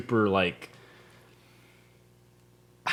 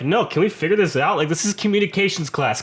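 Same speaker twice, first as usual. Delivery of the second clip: high, loud retold protest; exasperated pleading; 9 semitones higher, 5 dB louder than usual. Student demanding answers from professor, acted exasperation. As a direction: plead insistently, pitched up, building in volume.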